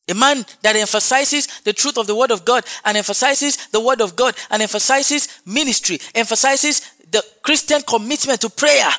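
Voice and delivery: impassioned preacher piling up phrases; forceful preaching. Real, not acted. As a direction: build the list up to a loud, high finish.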